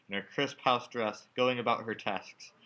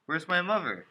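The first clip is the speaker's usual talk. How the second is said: higher, urgent, rising alarm; 5 semitones higher, 4 dB louder than usual. Acted. Son on the phone asking for his mother, alarmed. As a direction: demand it with growing worry.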